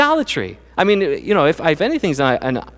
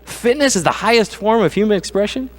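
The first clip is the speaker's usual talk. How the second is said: higher, disbelieving question; incredulous repeat; 7 semitones higher than usual. Retold or imitated. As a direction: echo the claim in mocking disbelief. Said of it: "Boy, that's idolatry, isn't it?".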